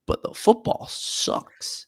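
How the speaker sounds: breathy, high whispered punchline; deadpan punchline. Real, not acted. Punchline after praising the program.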